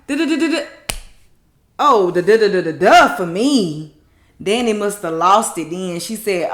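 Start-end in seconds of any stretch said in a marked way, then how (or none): 0.1-4.0 loud dismissive da da da; mock babbling, loud; mocking babble. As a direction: brush him off with nonsense syllables. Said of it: "Here go Gary to my".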